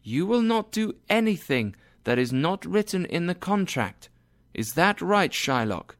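high, firm judge's voice; Portia as judge. A narrator's reading. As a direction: press Shylock from high in the voice.

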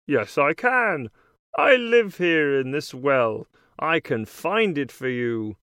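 lightly voiced frog character; a hint of a croaky frog. A narrator's reading. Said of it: "he tells her".

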